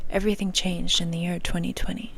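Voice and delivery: spooky whispered announcement; dramatic whisper. Shown as a demonstration. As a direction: whisper it like a movie trailer.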